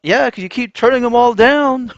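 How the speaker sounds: high, loud retort; exasperated yell. Acted. Man answering the crying women, exasperated shout. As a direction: yell back at them, fed up.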